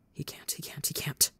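breathless whispered panic; panicked whisper. A narrator's reading. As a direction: whisper it, terrified and spiralling. Panicked inner thoughts.

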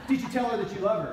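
put-on young convert grilling his friend; urgent challenge. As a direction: push him on it, eager and loud. Terrell presses Jeff.

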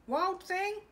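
high, loud call of a mother. A narrator's reading. Mother calling her son loudly. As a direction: call him sharply from another room. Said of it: "His mother called him".

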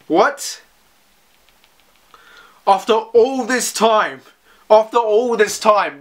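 can't believe what he just read; real shock, slowly repeating the phrase; stunned disbelief. Real, not acted. Shocked by the ending.

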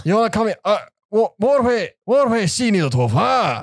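high, threatening Glaswegian, spilling into Chinese; aggressive Glasgow accent. Shown as a demonstration. Glaswegian menacingly offering a hair wash, aggressive accent. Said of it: "it's really aggressive".

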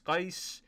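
"Qais" said as kais, rising; name as Qais. Shown as a demonstration. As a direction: land on "Qais" pronounced kais. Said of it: "it's a Q A Y S".

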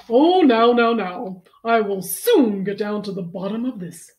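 loud, theatrical vet voice; confident vet. Acted. Vet reassuring George's mother, booming confidence. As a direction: take charge with booming assurance. Vet takes charge in the story.

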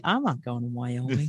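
low, quiet mock refusal; Donna. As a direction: lightly imitate a partner digging in, low and soft. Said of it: "And Donna would be like".

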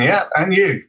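ordinary talk, nothing marked

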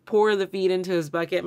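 usual speaking voice

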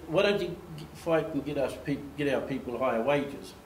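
ordinary talk, nothing marked

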